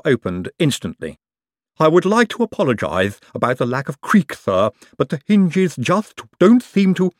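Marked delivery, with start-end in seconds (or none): 1.8-7.1 lisping servant Igor; apologetic, eager servant. A narrator's reading. Igor at the door.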